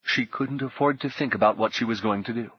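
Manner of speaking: ordinary delivery, nothing marked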